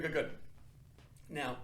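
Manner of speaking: usual speaking voice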